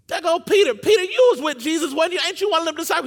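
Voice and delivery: high, loud, excited finger-pointing; loud accusation. Acted. Crowd spotting Peter among the scared followers, loudly accusing. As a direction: call him out in front of everyone.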